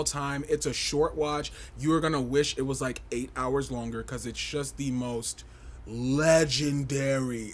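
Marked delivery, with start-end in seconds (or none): 5.9-7.5 "legendary" stretched out very slowly, louder and leaned on; stress on legendary. Shown as a demonstration. Hyping the documentary to viewers, a slow stretched-out "legendary". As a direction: draw out "legendary" slowly with heavy weight. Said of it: "emphasis on the word legendary".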